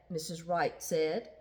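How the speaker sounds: ordinary delivery, nothing marked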